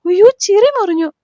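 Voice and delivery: a high, loud cry of alarm. A narrator's reading. Wife seeing her husband's cut lip, high loud alarmed cry. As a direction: shriek as she sees the blood.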